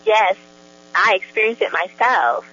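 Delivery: happy testimony tipping into a laugh; real joy. Real, not acted. Guest tells host she experienced it herself, joyful. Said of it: "you can hear the joy in her voice".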